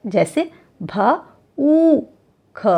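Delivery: भ, ऊ, ख said apart, slowly; sounded out slowly. Shown as a demonstration. Teacher to children, sounding out slowly. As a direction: sound out भूख piece by piece. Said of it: "ऊ का उच्चारण बड़ा होता है".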